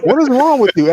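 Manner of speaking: high, loud, offended retort; half-joking outraged yell; indignant outburst. Retold or imitated. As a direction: snap back at the nosy asker. Indignant retort to rude question.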